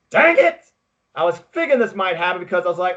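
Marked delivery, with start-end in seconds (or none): 0.1-0.7 high, loud "dang it" burst; frustrated outburst. Retold or imitated. Fan watching the match, frustrated yell. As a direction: blurt it out like a groaning fan.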